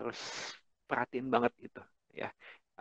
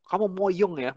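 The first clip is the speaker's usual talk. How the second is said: mock scolding parent; 8 semitones higher than usual. Parent scolding child quote.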